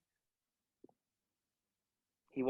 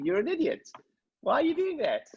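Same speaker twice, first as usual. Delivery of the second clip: high-pitched imitation of a blunt coach; playful telling-off, pitched well up; mock scolding coach; 11 semitones higher than usual.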